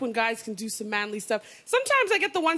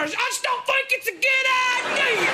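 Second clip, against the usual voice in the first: mock protester; 11 semitones higher than usual. Toothless man on CNN protesting health care, high mock shout. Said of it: "He's just sitting there".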